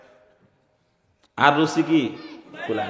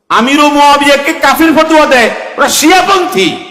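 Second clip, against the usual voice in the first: loud, high preaching at full force; fiery preaching; 7 semitones higher, 9 dB louder than usual. Acted. Preacher rouses the gathering, loud and high. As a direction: hammer the crowd like a fiery preacher.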